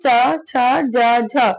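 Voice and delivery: च, छ, ज, झ drawn out very slowly, each letter apart; teacher sounding out च छ ज झ slowly; slow letter demo. Shown as a demonstration. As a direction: spell out च, छ, ज, झ one by one, very slowly for the class. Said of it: "बतस्य वर्ण चार है".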